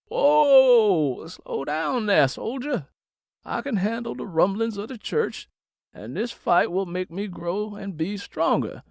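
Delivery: high, slowed-down whoa, reassuring; lightly voiced pastor reining in his daughter; calming father. Father calming his fired-up daughter on the phone, steady.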